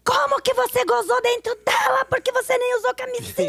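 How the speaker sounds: mock a wife losing her mind; imitating a frantic wife screaming in high singsong; hysterical wife. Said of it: "A mulher enlouqueceu".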